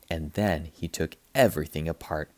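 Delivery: usual speaking voice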